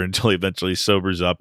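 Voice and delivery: usual speaking voice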